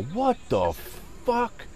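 mock yell climbing high on the last word; retold yell. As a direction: draw it out, pitch leaping up without real volume. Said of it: "I hear this guy yell".

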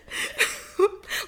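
high, loud burst of laughter; helpless laugh. Real, not acted. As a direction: crack up. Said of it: "cracking up".

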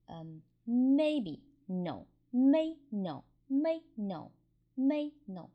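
a level "may" then a sharp, falling "no", like tone one into tone four; first tone then angry tone. Shown as a demonstration. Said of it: "first tone and angry tone".

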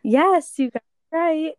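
high, loud cheer; excited. Real, not acted. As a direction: cheer the right answer. Excited at correct answer.

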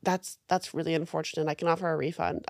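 low, soft, apologetic phone agent; imitated service agent. Corporate agent to annoyed customer, subdued apology.

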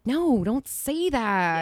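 high, loud, drawn-out mock telling-off; retold parental scolding. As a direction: act out a parent's dismayed no. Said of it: "And I'm like".

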